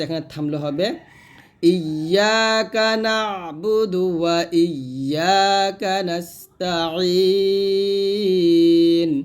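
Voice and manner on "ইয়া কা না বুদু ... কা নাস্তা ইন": slow chant with pressed ayn; drawn-out recitation, throaty ayn; tajweed recitation. Shown as a demonstration. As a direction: recite with long vowels and a long held ending. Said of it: "আইনটা হলকের মাঝখান থেকে চাপ দিয়ে".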